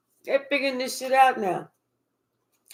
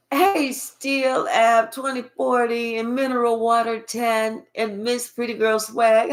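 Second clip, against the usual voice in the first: ordinary talk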